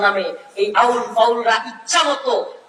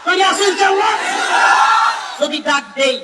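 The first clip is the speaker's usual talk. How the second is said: preaching at full force; 3 semitones higher than usual. Acted. Preacher to the waz crowd on God's power, preaching at full force. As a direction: proclaim God's might to the crowd like a revival preacher.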